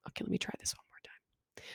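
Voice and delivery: unvoiced whisper; whisper to herself. Real, not acted. Teacher whispering to herself before redoing the recording. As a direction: mutter it to yourself in a whisper.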